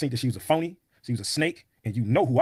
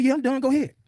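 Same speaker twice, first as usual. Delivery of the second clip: very high mocking voice; high put-on voice, curt reply; mimicked woman's retort; 13 semitones higher, 4 dB louder than usual. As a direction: imitate her giving way, pitched way up. Mimicking her reply in argument.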